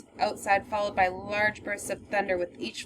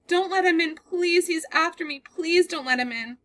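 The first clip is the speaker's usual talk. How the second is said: higher and louder, begging; terrified pleading; 8 semitones higher, 4 dB louder than usual. Acted. Girl begging the man to keep the door locked, terrified. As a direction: beg him to keep the door shut. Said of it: "She is sobbing and is out of breath".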